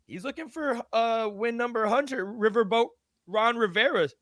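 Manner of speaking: talking through hiccups; hiccupping between words. Real, not acted. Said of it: "got the hiccups".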